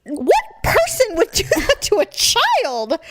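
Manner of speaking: high, slow laughing question; mock indignation through laughter; laughing disbelief; laughing outrage. Real, not acted.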